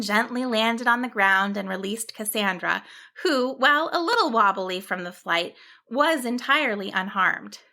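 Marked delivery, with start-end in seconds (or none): none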